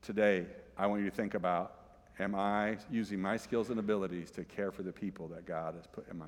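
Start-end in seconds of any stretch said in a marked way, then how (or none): none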